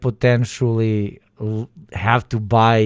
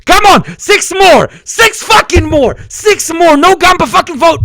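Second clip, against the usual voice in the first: much higher, very loud desperate scream; frantic screaming; 10 dB louder than usual. Real, not acted. Streamer screams at chat for six more votes, frantic shriek. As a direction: scream for the final votes in panic.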